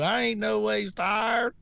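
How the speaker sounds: slow, mocking take on a put-on Southern accent; fake Southern accent. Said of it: "these fake accents".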